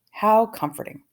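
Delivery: ordinary delivery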